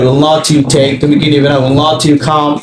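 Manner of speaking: ordinary delivery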